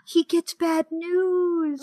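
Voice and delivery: high, slow, drawn-out lament; mock melodrama. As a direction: wail the plot twist theatrically. Melodramatic telling of plot twist.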